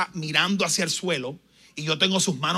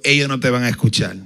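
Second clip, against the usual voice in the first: low, mocking demon voice; the demon taunting the preacher in a low voice; 4 semitones lower, 6 dB louder than usual. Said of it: "Me dijo".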